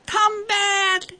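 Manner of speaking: high, far-carrying call; shouted call. A narrator's reading. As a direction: call after someone running off. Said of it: "though she shouted".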